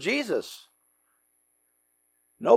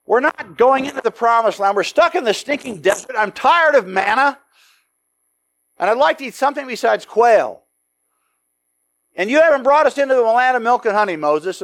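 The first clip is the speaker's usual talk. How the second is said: angry complaint; 4 semitones higher than usual. Acted. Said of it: "and they're angry".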